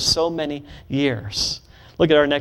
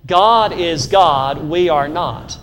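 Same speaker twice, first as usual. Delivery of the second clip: God is God, drawn out and louder; slow louder creed; 3 dB louder, slower than usual. Shown as a demonstration. Preacher leading the congregation in a loud, slow response. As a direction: call it out slowly with force on God. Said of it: "Say it again".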